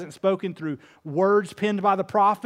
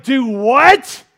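incredulous; 4 semitones higher, 9 dB louder than usual. Pretend. Preacher's incredulous reply.

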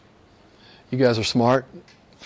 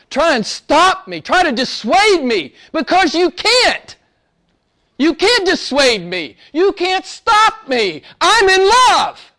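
higher and slower, swelling into a near-cry of devotion; fervent defiance; 10 semitones higher, slower than usual. Real, not acted. Preacher to congregation, defiant and lovestruck, high and slow. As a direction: declare it like nothing could hold you back. Passionate declaration of faith.

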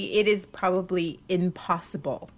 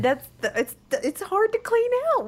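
mock innocent reply; 11 semitones higher than usual. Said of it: "and I said".

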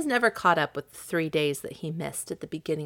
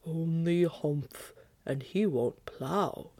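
ox voice; 4 dB quieter, much slower than usual. Acted. Ox voiced in story.

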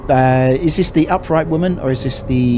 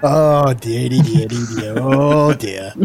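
light, amused tutting; a hint of mock dismay; casual, unbothered; slower than usual. Shown as a demonstration. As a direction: shrug it off with a chuckle. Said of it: "that sort of casual sort of like".

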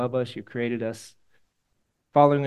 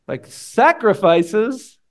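incredulous; 7 semitones higher, 8 dB louder, much slower than usual. Retold or imitated. Studd's incredulous reply.

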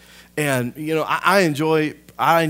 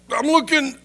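put-on high-pitched, loud kid reply; mock childish protest; imitated boy answering back; 6 dB louder than usual. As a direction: squeal it like a pestered little boy. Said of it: "And I'd be like".

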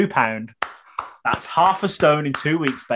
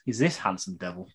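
ordinary talk

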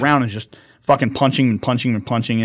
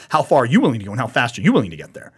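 ordinary talk, nothing marked

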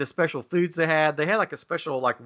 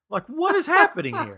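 exasperated outburst; 13 semitones higher, 6 dB louder than usual. Real, not acted. Exasperated retelling of the scene.

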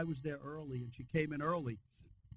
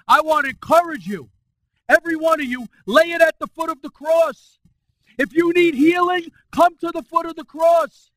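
higher, much louder preaching, holding words long; full-force preaching; 10 semitones higher, 19 dB louder than usual. Acted. Pastor exhorting his church to praise, full-force preaching.

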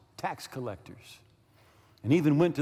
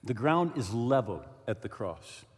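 solemn, quieter; 6 semitones lower, 4 dB quieter than usual. Acted. Solemn repeated key line.

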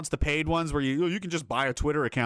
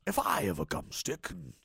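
slow menacing butcher growl; 5 semitones higher, slower than usual. Retold or imitated. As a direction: read it like the gangster butcher. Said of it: "Cue up the butcher voice".

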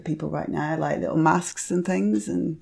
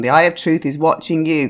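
ordinary delivery

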